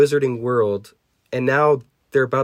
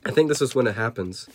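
plain reading voice

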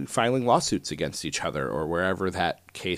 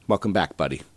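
ordinary talk

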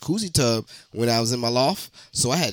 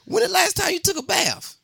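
incredulous shout. Real, not acted. Incredulous outburst at bath talk.

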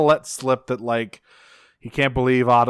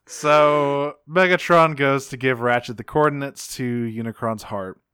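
ordinary talk, nothing marked

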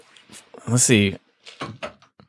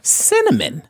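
cinnamon squeaked way up high; high exclaimed; slower than usual. Pretend. Exaggerated word reading.

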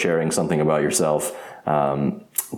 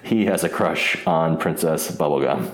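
normal talking voice

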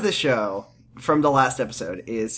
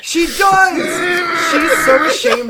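laughing outraged shout; 13 semitones higher, 6 dB louder than usual. Real, not acted. Shouting to friends about the show's worst line, cracking up. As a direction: shout it out, half crying with laughter.

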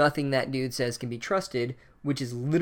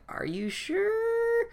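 much higher, very slow, eerie drawn-out question; ominous hat voice; much slower than usual. Retold or imitated. The Sorting Hat testing Harry's choice, slow eerie high voice. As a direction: stretch it out like a spooky tempter.

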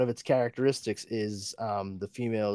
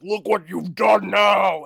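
higher, louder, slow roar of accusation; monster rage; 7 semitones higher, 7 dB louder, slower than usual. Retold or imitated. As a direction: bellow it as the freshly mutated man spider. Monster turns on her.

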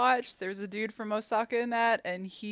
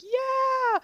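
high, loud excited yeah; mock group cheer; 4 dB louder than usual. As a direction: cheer like a pumped-up class. Classmates cheering.

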